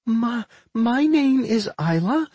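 slow, high, stammered introduction; high little twin, unsure of herself; hesitant. A narrator's reading. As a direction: stumble shyly into it in a high voice. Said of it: "the first twin said hesitatingly".

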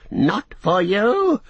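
high, drawn-out defiant wail; crying out. A narrator's reading. Gollum defying his far-off master, crying out. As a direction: wail your refusal, stretching it. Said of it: "he cried".

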